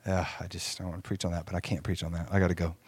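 low, very soft, under the voice; quiet aside. Real, not acted. Preacher reluctantly letting a tangent go, quiet aside. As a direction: drop right down as if talking to yourself. Holds himself back from a tangent.

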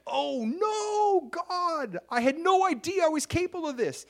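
high, loud gasping dismay; mock shock. A believer feigning shock to God, high and loud. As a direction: ham up sudden horrified surprise.

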